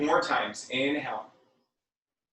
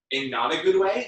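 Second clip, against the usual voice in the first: ordinary talk, nothing marked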